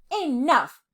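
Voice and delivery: loud, high bellow of a king. A narrator's reading. Parrot king silencing his subjects with a loud bellow. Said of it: "he bellowed".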